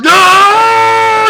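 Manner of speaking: long, high, loud mock wail; retold anguished cry. Reviewer imitating [name] crying over his cat, long loud wail. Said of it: "he's crying his ass off".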